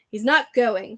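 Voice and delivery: firm and loud, putting a foot down; flat no, barked out; stern refusal. Acted.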